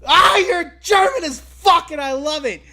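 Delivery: high, loud, gleeful shouting; excited yelling. Retold or imitated. Podcaster retelling his own reaction to Gunther, gleeful yelling. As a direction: cheer him on like a fan losing it. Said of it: "I was just like".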